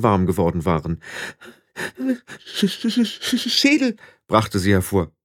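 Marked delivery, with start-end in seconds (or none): 2.4-4.0 high stammer through chattering teeth; freezing girl stammering. Acted. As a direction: stutter as if frozen to the bone. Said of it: "ihr klapperten die Zähne".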